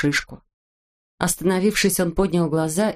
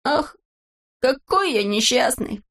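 higher, slow wail of self-pity; tearful lament; 6 semitones higher, slower than usual. A narrator's reading. Neznaika crying at the fence, tearful lament. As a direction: moan it, voice lifted and drawn out. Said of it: "и слёзы закапали из его глаз".

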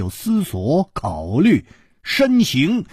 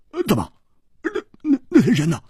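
high, stammering anxious questions; jittery, halting and pitched up; nervous; 9 semitones higher, much faster than usual. Acted. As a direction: stumble urgently into the question. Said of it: "胡铁花也紧张起来了".

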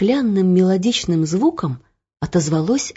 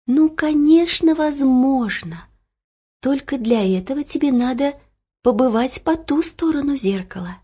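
patient; 6 semitones higher than usual. Acted. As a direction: explain it kindly, unhurried. Mirror explains patiently.

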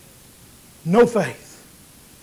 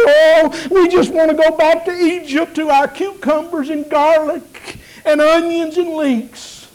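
mock whine; 7 semitones higher, 4 dB louder than usual. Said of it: "began to whine and moan and cry".

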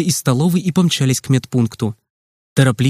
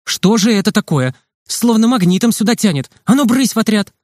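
angry nurse; 7 semitones higher than usual. A narrator's reading. Nurse chases boys back to their troop, angry scolding. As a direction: shoo them off crossly. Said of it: "Теперь сердитая медсестра кричала".

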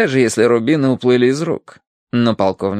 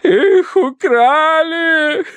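piercingly high, loud and drawn out; hysterical wail; 5 dB louder, much slower than usual. A narrator's reading. Wife reports the theft to the colonel, wailing. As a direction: lament a theft at full pitch. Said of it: "прорыдала она полковнику".

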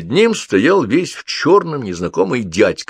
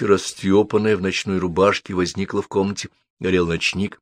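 usual speaking voice

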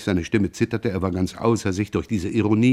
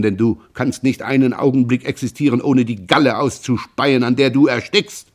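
angry accusation; 2 semitones higher, 3 dB louder than usual. Acted. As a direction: hurl the accusation. Jean keeps attacking his brother.

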